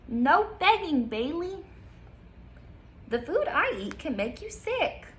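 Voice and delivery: bright storybook reading, slow on the details; reading to children. Acted. Teacher reading a picture book to kids, lively storytime voice. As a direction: read it aloud like storytime.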